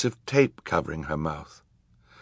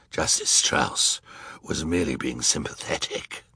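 as if hardly able to talk after hours of screaming; hoarse judge; 5 semitones lower, 5 dB quieter than usual. A narrator's reading. Third judge explaining Justice Strauss, very hoarse. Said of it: "This one was very hoarse".

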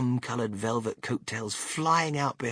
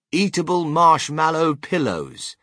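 loud, slow, raised announcement; reading out a sign; 5 semitones higher, 7 dB louder, slower than usual. A narrator's reading. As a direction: proclaim each word of the label.

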